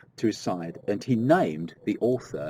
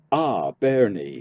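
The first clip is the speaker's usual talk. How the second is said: slow, louder storyteller's exclamation; mildly acted, drawn-out exclamation; exclaiming; 2 semitones higher, 6 dB louder, slower than usual. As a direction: exclaim it slowly, like an elder struck by a child. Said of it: "he exclaimed".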